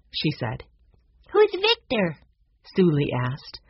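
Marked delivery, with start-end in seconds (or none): 1.3-2.2 put-on very high little-girl question; young girl. A narrator's reading. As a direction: ask in a high child's voice. Young Sue Lee asks.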